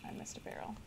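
whisper. Real, not acted. Whispered aside while looting.

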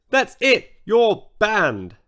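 loud, high stern order; stern banning. A narrator's reading. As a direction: lay down the law firmly. George banning him.

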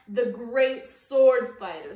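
loud proud announcement; proud boast. Acted. Skipito boasts his title.

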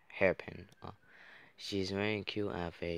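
normal talking voice

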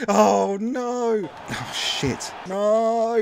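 high pained no; despairing cry. Real, not acted. Fan watching a late goal conceded, despairing cry. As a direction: howl in despair.

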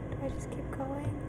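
soft pleading. Real, not acted. Pleading with a scary character.

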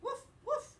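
high, loud woof woof; excited dog bark. Acted. Teacher barking as Biscuit for the class, loud and eager. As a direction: bark eagerly like a puppy at play.

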